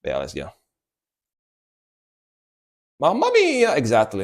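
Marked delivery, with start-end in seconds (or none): 3.0-3.7 mock Italian mamma mia.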